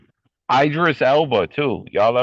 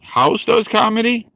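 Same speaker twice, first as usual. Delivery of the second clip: shocked disbelief; 13 semitones higher than usual. Real, not acted. Shocked that House does comedy.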